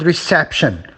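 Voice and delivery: reception with CEP stressed; re-CEP-tion, as a stress demo; stress on second syllable. Shown as a demonstration. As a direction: punch the middle of reception. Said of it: "the second syllable".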